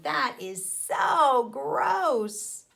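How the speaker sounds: slow, drawn-out disgust. Acted. Worm watching Spider molt, slowly disgusted. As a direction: stretch it out, grossed out. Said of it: "Worm is saying".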